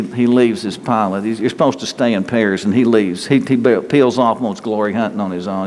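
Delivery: ordinary talk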